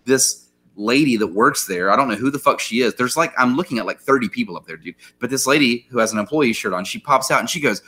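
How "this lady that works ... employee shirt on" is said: normal talking voice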